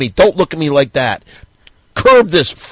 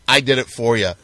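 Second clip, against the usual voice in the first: normal talking voice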